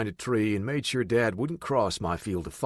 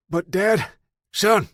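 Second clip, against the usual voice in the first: raised voices; 11 semitones higher, 8 dB louder, slower than usual. A narrator's reading. Heated exchange with father.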